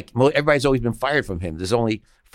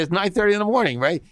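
disbelief; 10 semitones higher than usual. Retold or imitated. Disbelief at early arrival.

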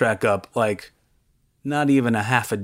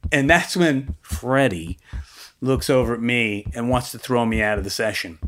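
normal talking voice

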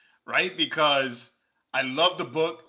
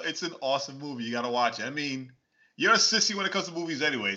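normal talking voice

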